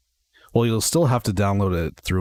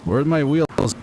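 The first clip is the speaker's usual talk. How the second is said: alarmed; 7 semitones higher, 3 dB louder, slower than usual. Real, not acted. Landing plane, gear missing.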